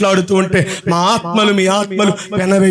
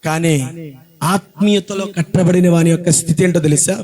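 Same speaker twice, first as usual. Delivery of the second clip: normal talking voice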